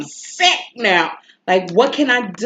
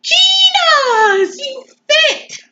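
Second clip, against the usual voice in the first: screaming far higher and louder in delight; ecstatic squeal; 7 dB louder, much slower than usual. Retold or imitated. Telling how the shirt finally fit, a squealing shout. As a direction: shriek it like you can't believe it.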